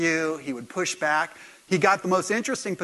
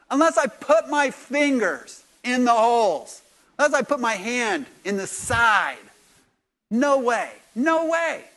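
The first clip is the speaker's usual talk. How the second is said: loud, higher, slow and stubborn refusal; a skeptic flatly refusing to believe; 7 semitones higher, 5 dB louder than usual. Retold or imitated. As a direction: dig in your heels, no way. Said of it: "And [name] said".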